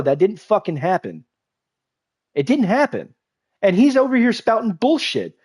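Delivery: ordinary talk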